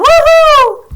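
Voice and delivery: high, loud whoop; screaming out. Acted. Teacher acts Maureen cheering Paul on, screamed whoop. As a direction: let out a big celebratory whoop. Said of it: "Screaming out".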